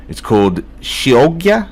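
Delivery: slow, hammed-up Italian pronunciation of Chioggia; mock Italian accent. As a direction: say Chioggia with a playful Italian flair. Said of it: "skilled pronunciations are gonna be at the forefront".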